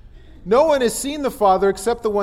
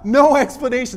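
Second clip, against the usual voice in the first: ordinary talk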